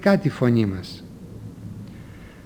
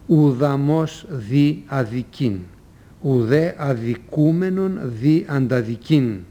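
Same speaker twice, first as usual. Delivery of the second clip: slow, careful reading of an ancient maxim; solemn slow recital of Socrates' words; slow recitation; slower than usual. Shown as a demonstration. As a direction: recite it slowly, word by word. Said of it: "είπε ο Σωκράτης".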